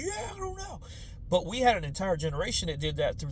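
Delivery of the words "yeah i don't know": much higher, softer vacant reply; robotic shrug. Retold or imitated. People in their robot phase, high and vacant. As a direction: answer like a dazed robot. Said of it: "we're just like".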